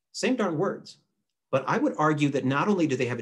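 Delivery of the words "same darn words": ordinary talk, nothing marked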